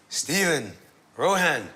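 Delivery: high, loud call from a window. Retold or imitated. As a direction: call them up from upstairs. Father calling from window.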